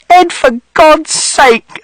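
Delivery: very high, slow exasperated cry; pleading outrage; mother shouting. A narrator's reading. Mother shouting at Mister Shears, exasperated. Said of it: "And mother shouted".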